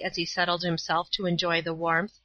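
ordinary delivery

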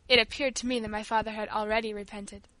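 plain reading voice

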